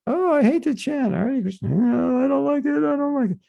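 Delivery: high, whining mock sulk about having to chant; whiny crying complaint. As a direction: whine it like a fed-up child. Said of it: "That's why we're crying".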